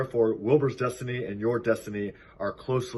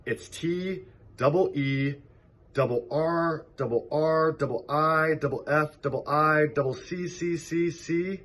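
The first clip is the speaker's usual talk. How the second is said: gander spelling; 7 semitones higher than usual. A narrator's reading.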